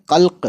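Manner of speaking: कल्क with a hard final k, no vowel after it; कल्क ending in a clean stop; clear Arabic qalq. Shown as a demonstration. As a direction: end कल्क sharply on the bare k. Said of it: "तो बस काफ की आवाज़ निकालेंगे तो कैसे पढ़ेंगे".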